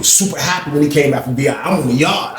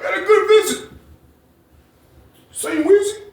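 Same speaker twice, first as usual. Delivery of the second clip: high, soft mouthed words; high quiet Jeff imitation; mimicking Jeff; 4 dB quieter than usual. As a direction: mouth it like from across the yard. Voicing Jeff mouthing again.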